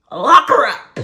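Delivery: sudden loud shout, pitch up; outraged shout. Real, not acted. Telling the story, she bursts out yelling. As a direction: yell it out in outrage. Outraged outburst about mother's plot.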